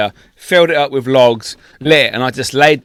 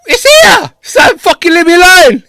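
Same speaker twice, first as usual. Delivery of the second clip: very high, loud protest; yelled comeback; 8 dB louder than usual. Retold or imitated. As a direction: shout back, fed up and exasperated. Retelling his yelled comeback.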